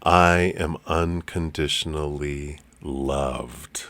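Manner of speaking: slow, soft affirmation with a rise on "I". Shown as a demonstration. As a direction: say it slowly, letting each word settle. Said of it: "alternate the emphasis on each of the words".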